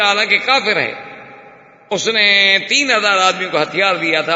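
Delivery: normal talking voice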